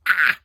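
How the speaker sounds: a sudden, loud, low squeal of delight; loud fangirl shriek; loud swooning squeal. Real, not acted. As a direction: squeal as the romantic line lands. Swooning over a romantic line.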